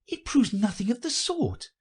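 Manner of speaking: put-on high girlish objection; very high, indignant girl voice; contradicting Alice. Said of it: "said Alice".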